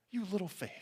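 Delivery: very soft, tender chiding; hushed, kindly reproach; not a slam. Retold or imitated. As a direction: gently chide with affection. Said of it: "was not like a, a slam".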